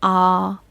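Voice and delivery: a clear open "Ah" for the class; model the sound. Shown as a demonstration. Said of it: "Can you say it with me?".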